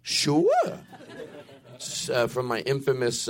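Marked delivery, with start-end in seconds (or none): 0.0-0.8 very high squeaky sure; heavy guy impression. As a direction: squeak it out eagerly. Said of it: "heavy guy at the hot dog cart".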